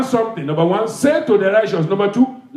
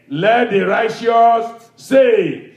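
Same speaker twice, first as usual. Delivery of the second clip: drawn-out refrain; much slower than usual. Real, not acted. Preacher calls the refrain out slowly to the church. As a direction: hold every word out like a call.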